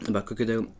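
chewing between words; talking while chewing. Real, not acted. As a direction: mouth partly full of cookie. Seen 4: Holds bitten cookie; jaw and lips move as if chewing between words.

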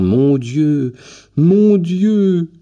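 put-on a flustered old man beside himself with joy; overjoyed old man. A narrator's reading. Old grandfather greeting his grandson's friend, flustered excited cries. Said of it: "courir égaré dans la chambre en faisant".

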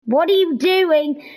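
normal talking voice

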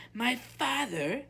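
high, loud, demanding princess; imperious princess, pitched high. A narrator's reading. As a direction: announce it like an entitled princess. Princess threatens the witch.

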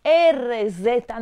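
usual speaking voice

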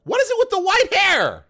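high, loud exasperated cry. Real, not acted.

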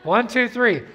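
rally the room for the count; big call to the congregation; loud count-in. Real, not acted. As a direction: count off loud, leading the crowd. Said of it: "let's start that again".

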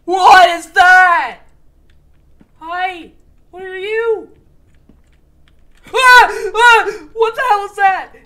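high, loud terrified shouting; scared horror game streamer. Real, not acted. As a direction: yell in panic at the monster. Monster chasing him in game.

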